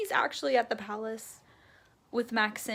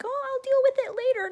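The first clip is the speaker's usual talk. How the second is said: much higher dismissive mock voice; mocking America; 13 semitones higher, 5 dB louder, faster than usual. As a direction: ape her brushing it off.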